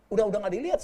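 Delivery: ordinary talk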